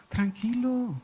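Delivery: usual speaking voice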